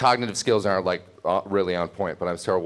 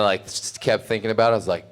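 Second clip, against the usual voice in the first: normal talking voice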